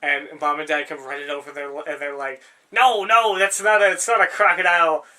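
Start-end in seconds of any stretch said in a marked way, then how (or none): 2.7-5.1 higher, louder exasperated scolding; parents scolding. Retold or imitated. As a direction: call out to the kids, half telling off the brother. Parents running over, scolding Derek.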